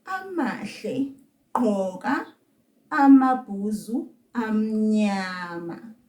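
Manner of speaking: slow, word by word, with pauses; slow demonstration. Shown as a demonstration. Teacher tells Amahle to put on boots, slowly. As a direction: draw each word out for learners. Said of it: "Let's say that slowly".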